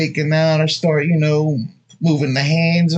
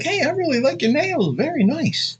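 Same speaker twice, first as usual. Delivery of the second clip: chirpy compliment; 13 semitones higher than usual. Shown as a demonstration. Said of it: "be like".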